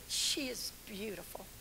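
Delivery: hushed whisper; awed whisper over a child. Retold or imitated. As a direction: breathe it out softly, unvoiced. Whispering about the little girl.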